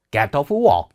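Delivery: "get off work" with a firm British t; British pronunciation. Shown as a demonstration. Teacher repeats the model for students, British accent. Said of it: "약간 요렇게 발음이 되거든요".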